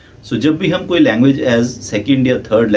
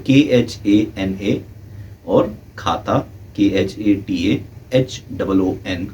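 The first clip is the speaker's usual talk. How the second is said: slow letter-by-letter spelling; 2 semitones lower, 4 dB quieter, slower than usual. Shown as a demonstration. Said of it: "teacher spelling out letters".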